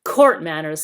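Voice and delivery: loud mocking sneer, court manners spat out. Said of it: "sneered Nickabrick".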